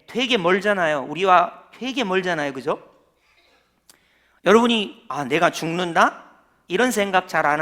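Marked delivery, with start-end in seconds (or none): none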